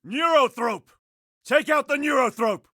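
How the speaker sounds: high-pitched alarm call; urgent alert. Acted.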